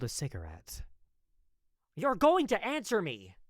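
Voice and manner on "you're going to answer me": sharp, loud and much higher angry demand; angry shout at his brother; snapped. Acted. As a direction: snap at him, fed up. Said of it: "I snapped".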